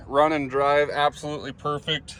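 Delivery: ordinary talk, nothing marked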